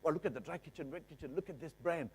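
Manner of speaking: mock starry-eyed gushing; a buyer gasping over the kitchen; dazzled buyer. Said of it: "they look at and say".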